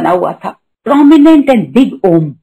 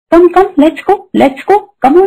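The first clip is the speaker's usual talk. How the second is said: higher, gently coaxing come come let's go; loving call to follow; 9 semitones higher, much slower than usual. Retold or imitated. Guruji in her dream beckoning her to follow, higher loving call. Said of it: "गुरुजी बड़े प्यार से बोल रहे".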